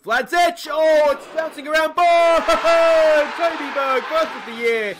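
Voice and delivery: high, loud goal celebration yell; excited goal shout. Real, not acted. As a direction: erupt as the ball goes in. Goal scored in football game.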